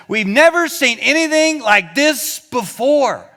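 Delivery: slow, loud and high, full of wonder; amazed. Shown as a demonstration. Preacher voicing the amazed crowd's exclamation. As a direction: proclaim it like a marvel. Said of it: "There's another version that says".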